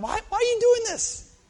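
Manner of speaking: very high, hammed-up wail of frustration; exasperated outcry. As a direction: cry it out as if driven nuts. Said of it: "It drives me nuts that that would happen".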